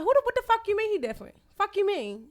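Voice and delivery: loud, high, furious snap; angry retort. Retold or imitated. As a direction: blast back at him in outrage. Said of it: "So I'm like".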